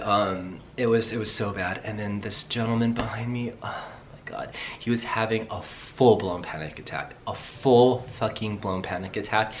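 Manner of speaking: ordinary talk, nothing marked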